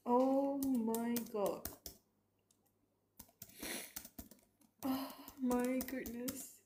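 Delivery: drawn-out, tearful exclamations; overwhelmed and crying, stretch each word out slowly; real crying. Real, not acted. Streamer reacting to a moving scene, crying. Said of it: "I'm crying like crazy right now".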